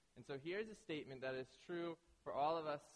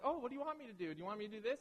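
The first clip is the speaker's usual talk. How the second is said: flustered mock pleading; 6 semitones higher, faster than usual. Fiance flustered by his correcting.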